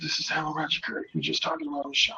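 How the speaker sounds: quick, hushed introduction; hushed reply. Retold or imitated. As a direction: keep it down to match a whisperer. Answers the whispering caller softly.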